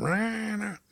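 high, mocking Southern "Rana" with extra Y sounds; exaggerated Southern twang. Southerner calling from his bunk, exaggerated twang. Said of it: "with a lot of Y's".

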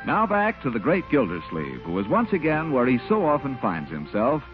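ordinary talk

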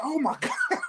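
high, startled squeal of fright; freaked out. Retold or imitated. Rapper's bandmate panicking over a cicada, mock shriek. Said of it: "he starts freaking out".

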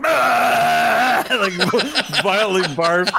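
high laughing shout breaking through narration. Acted. GM narrates general vomiting, laughing.

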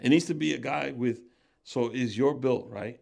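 ordinary talk, nothing marked

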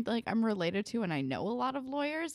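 ordinary delivery

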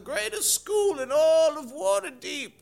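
high, slow, put-on character voice; a goofy groundskeeper showing off the school; Basil's voice. Groundskeeper welcoming new arrivals, high put-on voice.

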